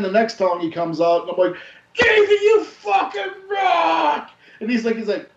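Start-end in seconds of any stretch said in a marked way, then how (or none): 1.9-4.3 put-on drunk scream, high and slow; drawn-out cracking drunken shout; drunken fan yell. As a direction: howl it at the stage, blitzed. Drunk fan yelling at concert.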